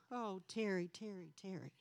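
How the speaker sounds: very soft, low, dwindling reproach; disappointed sighing reproach. Retold or imitated. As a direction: softly despair of someone, fading away. Demonstrates disappointed remark to her kids.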